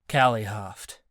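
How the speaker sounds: ordinary delivery, nothing marked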